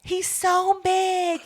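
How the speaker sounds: high, loud, slow mock voice; mock whiny voice. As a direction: ham it up, slow and squeaky. Mocking exaggerated voice.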